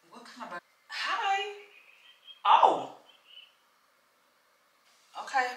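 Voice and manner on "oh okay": ordinary talk, nothing marked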